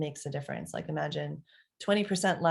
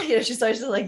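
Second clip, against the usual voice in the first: ordinary talk, nothing marked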